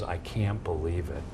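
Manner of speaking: low, hushed and slow, in disbelief; doctor's stunned hush. Retold or imitated. Doctor staring at the X-ray, hushed disbelief. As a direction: breathe it out slowly, stunned by the scan. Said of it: "the doctor goes".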